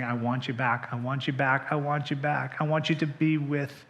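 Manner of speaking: a hint of God's tender longing; tender plea. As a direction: gently call the lost one home. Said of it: "he's saying".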